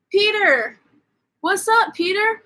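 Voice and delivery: high-pitched mock greeting call. People at the jail greet Peter, high mock calling-out.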